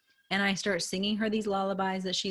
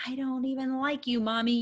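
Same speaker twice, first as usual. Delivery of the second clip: higher, lightly voiced upset little girl; imitating her child; 7 semitones higher than usual. Mother retelling her daughter's mean bedtime jab, lightly childlike. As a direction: mimic a hurt kid's jab. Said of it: "the meanest thing she could think of".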